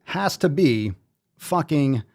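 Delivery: drawn-out winner announcement. Pretend.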